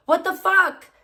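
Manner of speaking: startled swear at the computer; exasperated outburst. Real, not acted. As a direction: blurt it out in disbelief. Computer misbehaves.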